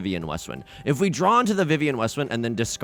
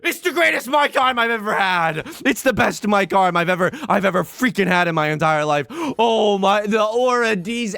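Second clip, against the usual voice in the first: much higher, louder gushing; overjoyed; 8 semitones higher, 5 dB louder than usual. Real, not acted. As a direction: rave about it like a fan. Gushing over new mic arm.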